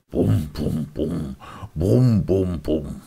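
booming bum bum like a big bass; playful bass-drum mimicry; bass drum imitation. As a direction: thump out the bass line. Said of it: "der große Brummbass".

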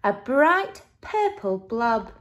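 usual speaking voice

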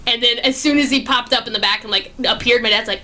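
ordinary talk

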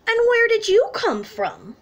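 a lightly played cheeky duck. Duck and bunny voiced in story.

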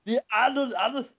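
put-on Austrian action hero accent; Arnold voice. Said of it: "just doing an Arnold voice".

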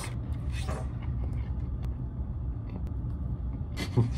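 breathless voiceless laugh at awful food; disgusted laugh. Real, not acted. Tasting bad hotel potatoes on camera, breathless laugh. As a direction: laugh silently through a bad mouthful.

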